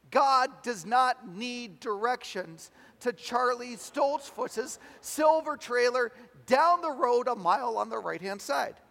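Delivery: loud, high, drawn-out objection; exasperated protest. Acted. Preacher voicing Tony's urge to interrupt the prayer, loud exasperation. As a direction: hammer each word out slowly in exasperation.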